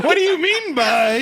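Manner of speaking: high, laughing repeat of the line; laughing echo. Retold or imitated. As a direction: echo it while cracking up. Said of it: "echoing friend's quote".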